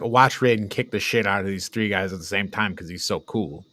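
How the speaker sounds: ordinary delivery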